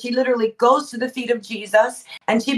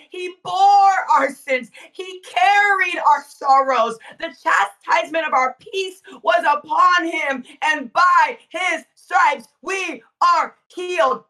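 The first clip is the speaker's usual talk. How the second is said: full-force preaching; 5 semitones higher than usual. Acted. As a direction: proclaim each word, slow and ringing.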